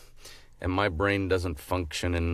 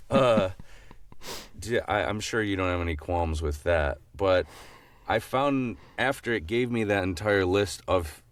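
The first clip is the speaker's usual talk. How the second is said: ordinary talk, nothing marked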